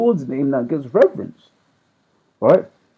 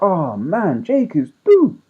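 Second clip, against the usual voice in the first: exasperated dismissal; 7 semitones higher, slower than usual. Retold or imitated. Mock exasperated reaction.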